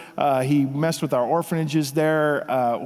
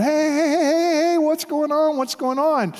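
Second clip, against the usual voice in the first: calling out; 11 semitones higher than usual. Retold or imitated. Breaking up a confrontation on boat.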